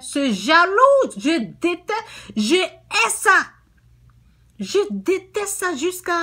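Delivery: high, loud, slowed denunciation; fiery preaching. Real, not acted. Preacher denounces jealousy in the family, at full force. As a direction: thunder against jealousy from the pulpit.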